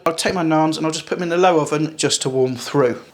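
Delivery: usual speaking voice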